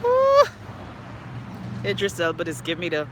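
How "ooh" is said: high, loud "ooh"; swooning "ooh". Real, not acted. Fan swooning over actor, high loud "ooh". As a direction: let out a high whoop of admiration.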